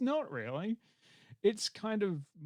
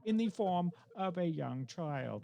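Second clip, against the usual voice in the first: movie trailer narration; 5 semitones higher than usual. Acted. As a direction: ham up the synopsis like an epic film trailer. Reads synopsis in trailer voice.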